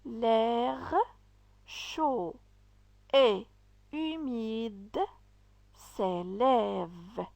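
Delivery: each word set apart by pauses, slow; halting demonstration reading. Shown as a demonstration. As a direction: read it one word at a time so learners can follow. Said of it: "On répète la lecture".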